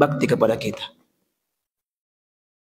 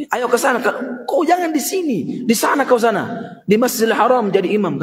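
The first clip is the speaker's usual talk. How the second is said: high scolding send-off, a touch mocking; mother scolding; 6 semitones higher than usual. Mother scolding her restless son, high exasperated voice. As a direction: shoo the child away, half cursing. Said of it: "sumpah-sumpai anaknya".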